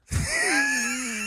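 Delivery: big high cackle. Real, not acted. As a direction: burst of shrieking laughter. Laughing at own bit.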